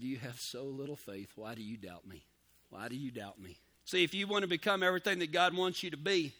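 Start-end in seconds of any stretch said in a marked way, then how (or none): 0.0-3.6 lower, softer, calm chiding; Jesus catching Peter, softly asking why he doubted; gentle rebuke. Retold or imitated. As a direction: quietly ask it like a patient friend.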